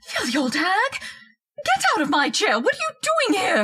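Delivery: angry scolding of an intruder; indignant outrage. Acted. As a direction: berate the old woman furiously. Wife scolding intruding old woman.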